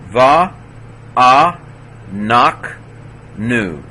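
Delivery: va, a, nak, nu, each alone with long gaps; teacher's spaced-out breakdown, stepping down to nu; syllable by syllable. Shown as a demonstration. Teacher sounds word out by syllables.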